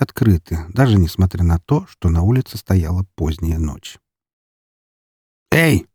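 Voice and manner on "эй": sharp, high barked shout; barking shout. Acted. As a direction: bark it at the intruders. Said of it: "рявкнул один из охранников".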